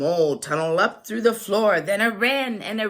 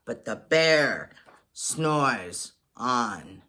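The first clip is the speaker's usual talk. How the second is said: slow low refrain; 9 semitones lower, slower than usual. A narrator's reading. Sleepy refrain.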